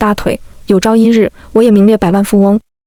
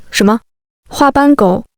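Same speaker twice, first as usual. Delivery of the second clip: mild mock yell. A narrator's reading. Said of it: "我大吼一声".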